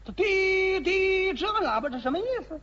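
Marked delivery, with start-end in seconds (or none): 0.1-1.3 long, high, held honks; truck horn imitation.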